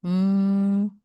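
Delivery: a steady humming Mm to feel the vibration; nasal hum. Shown as a demonstration. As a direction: hum so the nose buzzes. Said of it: "So nasal sound".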